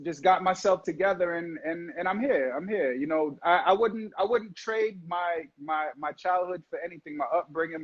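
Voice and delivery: usual speaking voice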